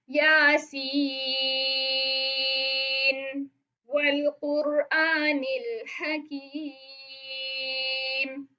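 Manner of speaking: Yasin held long, pause, then वल कुरआनिल हकीम slow; recitation with pause. Shown as a demonstration. Teacher demonstrating stopping at the verse end, slow recitation. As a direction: stop after Yasin, then recite slowly. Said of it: "यानी आप पढ़ें".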